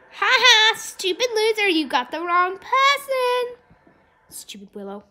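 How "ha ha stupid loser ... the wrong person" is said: high, sing-out mockery; gloating taunt. Real, not acted. Player to opponent who missed, high gloating taunt. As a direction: crow over a beaten rival.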